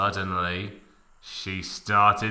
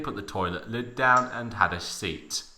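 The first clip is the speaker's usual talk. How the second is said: ordinary delivery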